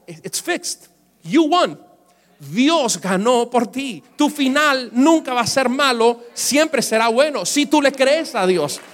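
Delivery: loud, high preaching proclamation; preaching at full force. Acted. Pastor to congregation, loud preaching proclamation. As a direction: proclaim God's victory to the church.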